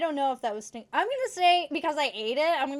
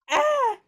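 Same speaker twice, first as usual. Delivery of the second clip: disgusted shriek; 8 dB louder than usual. Real, not acted. Gross bean reaction.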